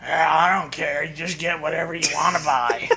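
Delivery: low, quick and dismissive; lightly voiced lazy Nappa; careless Nappa voice. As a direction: brush it off, low and fast. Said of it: "he has to be like".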